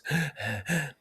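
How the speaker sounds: high, quiet asthmatic gasp for air; breathless gasp. Acted. Piggy out of breath before the assembly, gasping. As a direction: catch your breath wheezing.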